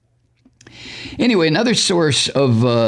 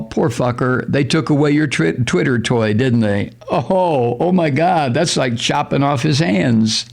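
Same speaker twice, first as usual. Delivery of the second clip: usual speaking voice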